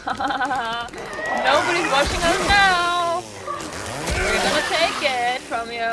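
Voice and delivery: loud, high villain cackle and taunt; gloating conqueror. Acted. Norwegian conqueror taunting Sweden, loud and high. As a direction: crow over the beaten Swedes, loud and high.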